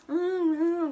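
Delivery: high-pitched "mm, mm"; mock oblivious humming. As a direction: hum it high, mimicking pushy people. Mimics people pushing out of elevator.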